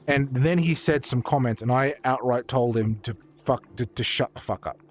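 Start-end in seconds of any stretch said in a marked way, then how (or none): none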